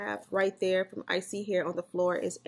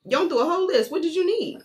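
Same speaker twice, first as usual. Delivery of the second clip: high, loud exasperated mom; exasperated scolding; 10 semitones higher, 8 dB louder than usual. Real, not acted. Mom scolding kids who forgot their Target order, loud and exasperated. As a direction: let them have it for not speaking up.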